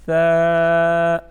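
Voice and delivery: long, loud soft ثا, held on one note. Shown as a demonstration. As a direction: draw out ثا with a soft consonant. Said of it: "نرم حرف ہے". (نرم اور لمبا ثا)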